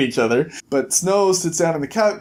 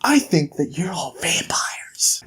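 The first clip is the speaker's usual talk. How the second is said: slow, secretive whisper; conspiratorial whisper; slower than usual. Acted. Reporter tells Bigby his theory in a slow whisper. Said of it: "Tommy Sharp says".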